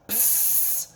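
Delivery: long hissed "psst" like a spray can; soft voiceless aerosol hiss; imitated spray noise. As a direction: mimic mace spraying, all breath.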